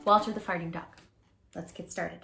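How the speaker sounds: plain reading voice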